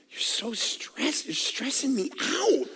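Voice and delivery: soft slow whisper; whispered complaint. Retold or imitated. Pastor imitating a listener, whispering that he stresses them. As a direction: breathe it out in a whisper. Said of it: "We don't need you yelling".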